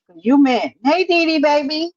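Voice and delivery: normal talking voice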